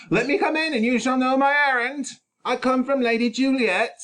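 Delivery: high, loud nurse calling through the door; nurse's voice. Acted. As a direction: pitch it up as the old nurse. Nurse's voice, much higher.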